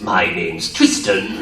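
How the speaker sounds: a hint of sly Bakura, slowly; Bakura line. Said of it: "his line to get back into his voice".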